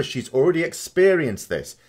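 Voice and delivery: ordinary delivery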